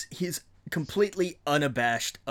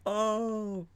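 a high, hushed, deflated "oh"; imitating the room's awkward "oh"; stunned oh; 9 semitones higher than usual. Coworkers' awkward reaction to burn.

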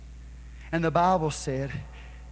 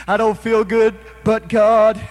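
high, loud, rising sermon cadence; preaching at full force; 8 semitones higher, 11 dB louder than usual. Acted. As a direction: preach it hard, each "but God" a hammer blow.

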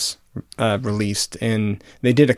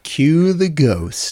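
spooky announcer; 4 semitones higher, much slower than usual. Pretend. Spooky outro cue.

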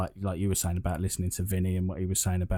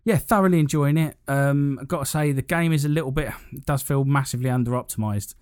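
excited; 6 semitones higher, 5 dB louder than usual. Real, not acted. Excited about the game.

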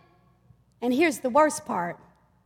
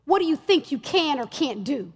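usual speaking voice

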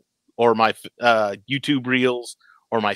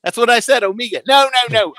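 put-on Kiwi accent; 10 semitones higher than usual. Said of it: "your little Kiwi accent".

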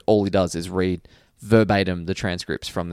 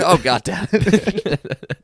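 slow, higher dopey cop voice; Wiggum voice; 8 semitones higher, 4 dB louder, much slower than usual. Retold or imitated. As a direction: drag it out like Wiggum. Quoting Wiggum again.

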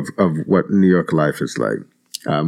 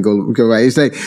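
usual speaking voice

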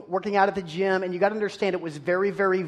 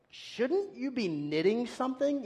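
snide inner retort; 5 semitones higher, 7 dB quieter than usual. Retold or imitated. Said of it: "all I can think about is".

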